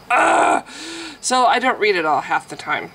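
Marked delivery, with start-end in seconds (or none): 0.1-0.6 disgusted groan. Real, not acted.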